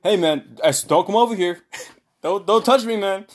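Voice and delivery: high, loud mimicry of a wary friend; mock panicky keep-off plea; retold alarmed protest. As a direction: act out pushing help away. Imitating friend refusing help.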